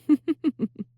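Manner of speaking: high, gloating demon laugh; gleeful laugh. Acted. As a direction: cackle at your own cleverness. Gloating over her clever plan.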